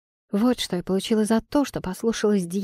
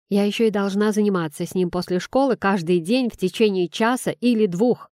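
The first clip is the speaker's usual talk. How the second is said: ordinary delivery, nothing marked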